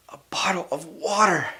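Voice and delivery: gasp, then a hushed whisper; breathless whisper as if spotting salvation; whispered wonder at the water; gasp of discovery. Acted. Parched traveller spots water.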